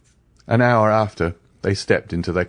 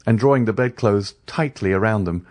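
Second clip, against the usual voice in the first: usual speaking voice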